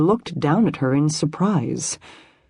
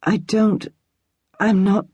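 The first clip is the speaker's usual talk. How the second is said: stuttering; 5 semitones higher than usual. A narrator's reading. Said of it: "Micah stuttered".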